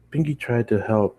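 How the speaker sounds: usual speaking voice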